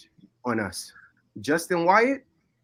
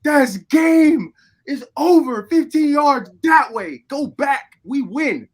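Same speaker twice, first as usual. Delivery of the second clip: high, loud, overjoyed yelling of victory; ecstatic shouting; 10 semitones higher, 8 dB louder, slower than usual. Retold or imitated. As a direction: shout it out like you just won the game. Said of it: "clapping with tears, saying".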